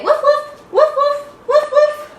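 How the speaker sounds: very high, quick woofs; playful high puppy woofs; dog woofs. Shown as a demonstration. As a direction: bark like a little dog. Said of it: "And say".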